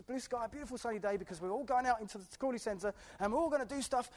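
ordinary talk

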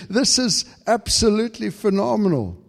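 high, breathless repeated thank you; lifted high in awed thanks to God; overwhelmed thanks. Retold or imitated. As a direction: gush your gratitude upward. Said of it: "I just came to God".

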